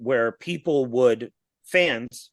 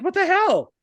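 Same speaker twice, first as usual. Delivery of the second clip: higher-pitched imitation of a shocked kid; startled kid; 4 dB louder than usual. Retelling a cut scene as the kid, startled. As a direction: exclaim it as the alarmed kid, pitch jumping up. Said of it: "the kid's like".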